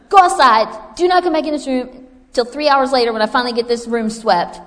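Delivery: loud, high scolding shout; yelling at kids. Retold or imitated. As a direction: chase the children out of the room. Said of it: "I'd be like".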